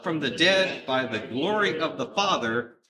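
loud, measured public Bible reading; scripture reading. Acted. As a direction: read the verse out strongly for a congregation to join in. Reads scripture aloud with congregation.

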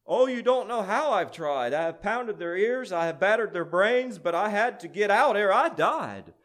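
loud, high, building tale of struggle; impassioned protest. A narrator's reading. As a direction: plead your case with desperate force. Jealousy protests to the prince.

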